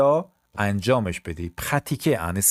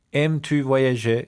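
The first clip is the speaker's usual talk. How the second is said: slow repeat; 3 semitones higher, much slower than usual. Shown as a demonstration. Teacher asks pupils if they like travelling, slowly. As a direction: say it slowly for learners.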